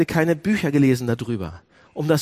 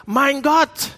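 higher, louder anguished call to God; Jesus crying out on the cross; 8 semitones higher, 5 dB louder, much slower than usual. Acted. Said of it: "Er sagt".

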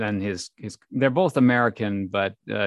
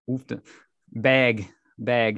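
Minnesota accent; 2 semitones higher, slower than usual. Shown as a demonstration. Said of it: "see if I can lean into it".

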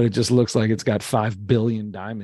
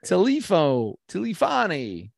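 put-on old-timey New Yorker; 7 semitones higher, slower than usual. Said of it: "talk like an old-timey New Yorker".

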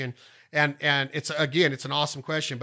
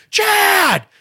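loud high shout leaning on the name; mother shouting the child's name; 8 dB louder than usual. Retold or imitated. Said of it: "She's gonna stand on your name".

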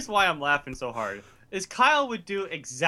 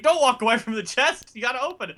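mock exasperated GM; 10 semitones higher, 6 dB louder than usual. Imitating the frustrated game master.

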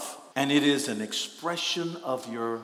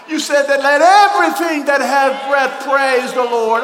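shouted, much higher and louder praise; full-force praise; 12 semitones higher, 13 dB louder than usual. Shown as a demonstration. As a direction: cry it out with everything. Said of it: "That's called Tehillah".

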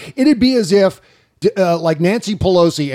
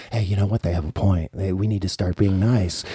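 quieter, lower, meek imitation of appeasers; meek mock voice; 8 semitones lower, 6 dB quieter than usual. Host mocking Democrats who want to be nice, in a meek softer voice. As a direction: do a timid, softer voice giving in. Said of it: "people are like".

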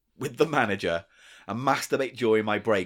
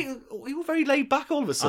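mocking; 11 semitones higher, much faster than usual. Teasing co-host about laid back.

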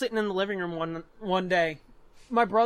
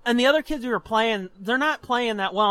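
ordinary delivery

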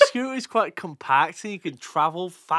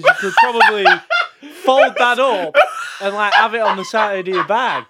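loud, giggling through the words; laughing; 5 semitones higher, 7 dB louder than usual. Real, not acted. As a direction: laugh while pitching the idea. Laughing through scooter idea.

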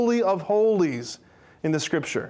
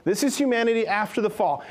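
ordinary talk, nothing marked

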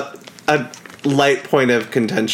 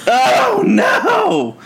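high-pitched wail of dismay; real dismay; 10 semitones higher, slower than usual. Real, not acted. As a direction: cry out over a spilled drink. Just spilled champagne mid-impression.

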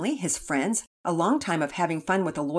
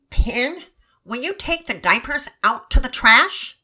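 pinched-nose nasal voice, raised and louder; exaggerated nasal voice; 6 semitones higher, 7 dB louder, slower than usual. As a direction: talk through a pinched nose, hamming it up. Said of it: "in an exaggerated nasally voice".

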